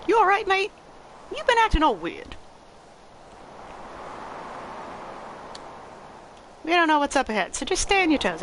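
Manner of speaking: a friendly Aussie-ish mate checking in. Acted.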